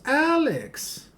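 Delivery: very high-pitched mock yell; imitated yell. Girl comes through the door calling her sister, mock yell. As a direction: holler the name in a high, hammed-up voice. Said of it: "she immediately yells".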